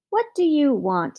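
a restrained angry shout; angry bellow. A narrator's reading. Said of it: "he bellowed".

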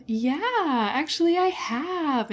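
high, far brighter, overly agreeable yes; mock eager reply. Answering the doctor's therapist question, mock eager. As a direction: lean hard into it, sing-song agreeable. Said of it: "really leaned into the".

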